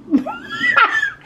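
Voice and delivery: shrieking high laugh; sudden high-pitched laughter, real; high burst of laughter. Real, not acted. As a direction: let out a real squealing laugh.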